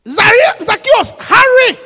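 slow, high shouted call. Real, not acted. Preacher shouts the call to the church. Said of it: "preacher shouts prompt".

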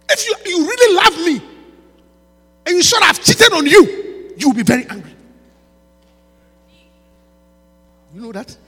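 loud, high, forceful preaching; preaching shout. Real, not acted. Preacher shouting at listeners about love and anger, loud. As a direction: drive the point home at full volume. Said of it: "I'm preaching".

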